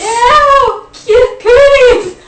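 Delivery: very high, loud drawn-out squeal; mock disgusted shout. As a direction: shriek it in mock playground disgust. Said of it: "I want you to shout".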